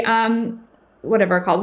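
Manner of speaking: ordinary talk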